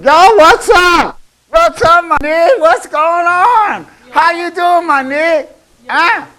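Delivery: high, loud, drawn-out street slang greeting; slangy street greeting. Retold or imitated. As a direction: call out to a friend in a big, high, loud voice. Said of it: "and he came up to you and said".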